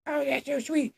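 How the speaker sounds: very high, soft, breathy-sounding gush; a hammed-up smitten teen; swooning mock Emma.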